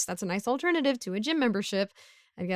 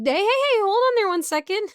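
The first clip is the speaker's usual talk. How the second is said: high, loud call to stop a passerby; hey, wait up, loudly and high; 11 semitones higher, 7 dB louder than usual. Acted. Neighbor calling after someone trying to leave, loud and high.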